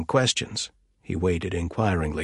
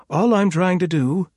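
exasperated; 10 semitones higher, 6 dB louder than usual. Acted. Inga defends herself, exasperated.

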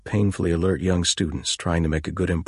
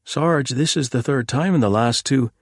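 put-on higher, eager young policeman; young constable voice; 8 semitones higher, 5 dB louder than usual. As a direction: play the keen young constable.

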